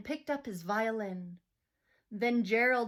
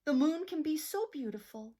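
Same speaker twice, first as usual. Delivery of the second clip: ordinary delivery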